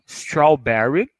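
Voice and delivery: "strawberry" stretched out slowly, each syllable clear; slow strawberry again. Shown as a demonstration. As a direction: draw "strawberry" out slowly for learners. ("strawberry" bem devagar, repetido)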